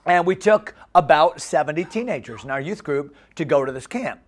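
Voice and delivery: normal talking voice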